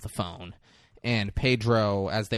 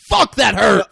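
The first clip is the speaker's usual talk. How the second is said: high, loud yell of pain; 9 semitones higher, 12 dB louder, slower than usual. Retold or imitated. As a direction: yell it like your hand just hit concrete. Acting out man punching concrete.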